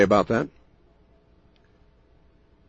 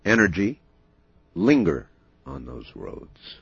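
ominous late night radio; 7 semitones lower than usual. Acted. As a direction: brood darkly into the mic. Host's ominous late night monologue.

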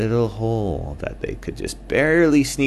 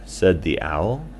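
plain reading voice

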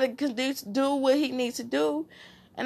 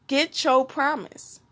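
ordinary delivery

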